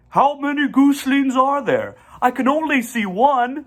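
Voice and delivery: a goose peering at the nest; high goose voice. A narrator's reading. Charlotte asks the goose about her brood in a high voice.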